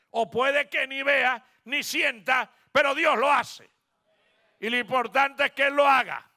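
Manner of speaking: loud, high pulpit proclamation; impassioned preacher's cry; preaching at full force. Acted.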